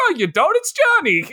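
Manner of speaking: insistent caricature, pitch leaping up; mock movie character. Podcaster parodying characters who won't explain, high mocking voice. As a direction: brush off the objection in a high silly voice.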